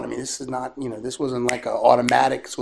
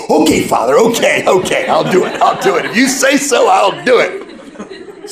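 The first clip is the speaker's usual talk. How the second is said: loud, higher, laughing yes-yes; eager agreement; 6 semitones higher, 11 dB louder than usual. Retold or imitated. Mary agreeing to the priest's penance, excited laughing shout. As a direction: blurt it out happily, over and over.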